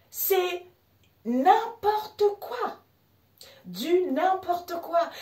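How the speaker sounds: high, drawn-out exasperation; squeaky-high, slow disbelief; incredulous amusement. Real, not acted. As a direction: stretch each word out high in amused disbelief. Mocking absurd automatic subtitles.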